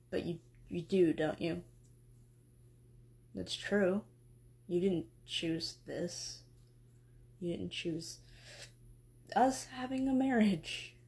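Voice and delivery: low, mumbly, just-woken god; drowsy, mumbling smith god; lightly voiced groggy Hephaestus. Hephaestus figure, hurt.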